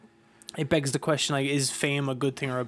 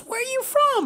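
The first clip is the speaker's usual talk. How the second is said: friendly rock star; 4 dB louder than usual. Retold or imitated. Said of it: "he goes".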